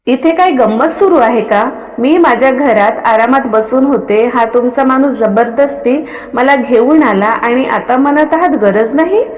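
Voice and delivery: plain reading voice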